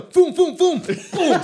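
shouted booms of a crash; loud boom sound effects. Retold or imitated. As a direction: bang out the impacts loudly.

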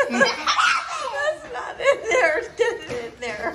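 high, slow, laughing hard. Real, not acted. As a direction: squeal it out laughing.

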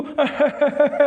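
mock ghostly chuckle in a string of ha-has; imitated ghost laugh. Frightened man shows companion how the ghost laughed, imitated laugh. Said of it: "ನಗ್ತಾ ಇತ್ತು".

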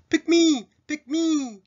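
very high, drawn-out plea; eager pupil. Acted. Pupil wanting to be picked in class, high eager plea. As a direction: beg the teacher in a high voice. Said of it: "言いたいときは".

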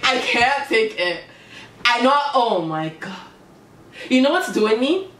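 ordinary delivery

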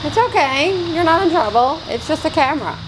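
high gentle soothing for a scared cat; reassuring pet talk. Real, not acted. Owner calming a cat spooked by the camera, reassuring and high.

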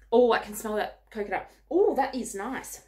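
ordinary delivery

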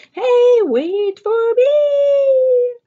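high, loud, drawn-out cry to the others; calling out. Acted. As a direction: stretch it out like a kid left behind. Child calls after the others.

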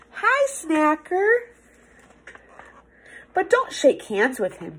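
ordinary delivery, nothing marked

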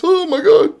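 very high, loud panicked squeal; exaggerated frightened victim; mock terror. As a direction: plead in a hammed-up falsetto. Mock terrified victim of street seller.

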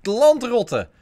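loud, high pirate hail; pirate greeting. Pretend. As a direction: bellow it like a pirate.

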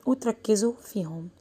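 ordinary talk, nothing marked

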